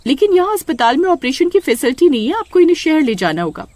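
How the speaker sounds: high-pitched worried village doctor. Acted. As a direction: raise the voice for the anxious doctor. Doctor continues. (ऊँची आवाज़ में चिंतित doctor)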